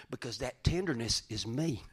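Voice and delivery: usual speaking voice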